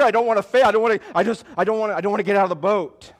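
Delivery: loud, hurried mock protest; acted fearful refusal. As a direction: blurt out repeated refusals in mock fear. Acts out fear of failure.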